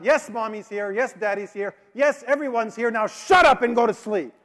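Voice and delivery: put-on an exhausted businessman finally snapping at the kid; tired businessman yelling at the boy across the sleeping car; exasperated yell. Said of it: "And so he called out".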